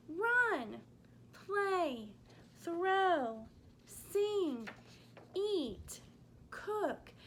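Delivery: bright, punchy action words; energetic. Shown as a demonstration. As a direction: pop each action word with energy. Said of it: "action-packed".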